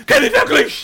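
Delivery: sudden high-pitched yell; hammed-up anguished fan shout; mock night-time cry. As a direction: cry out as if jolting awake from a dream. Fans waking at night crying out.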